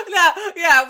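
laughing. Real, not acted. Laughing at a joke.

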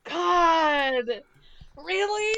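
high, loud astonished cry; surprised shout. Real, not acted. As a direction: blurt out in shock. Surprised by the meme date.

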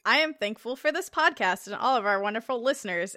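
ordinary delivery

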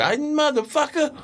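high, drawn-out mock cursing; mock outburst. Retelling how that dude went off, mock angry. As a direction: stretch the curse out in a high pitch. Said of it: "that dude went off on me".

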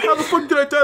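mock the victim's confused outrage; freaked-out kid. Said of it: "Like, he's freaking out".

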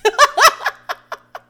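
high burst of laughter; loud laugh. Real, not acted. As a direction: crack up loudly at your own words. Laughing at her own rant.

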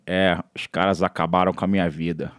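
low mumbled parody of a dubbed action star; Roger impression. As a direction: drop way down and slur like Roger. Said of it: "O Roger, eu lembro uma cena".

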